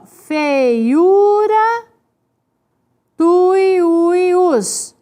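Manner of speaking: "Feiura" and "tuiuius" slowly drawn out; stretched syllables. Shown as a demonstration. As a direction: sound out "Feiura", pause, then "tuiuius". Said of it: "Então, é assim".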